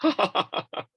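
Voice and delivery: hammed-up high laugh; demo laugh. Shown as a demonstration. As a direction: show the mockers laughing. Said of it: "Terus ketawa".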